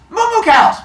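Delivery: high, very loud holler; gleeful sing-out shout; calling the cows. Acted. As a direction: summon a herd from afar. Hermes calls out to hidden cows.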